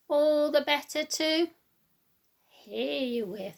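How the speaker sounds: slow, drawn-out wolf answer; slow wolf reply. Acted. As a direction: stretch the words out slyly. Wolf's reply.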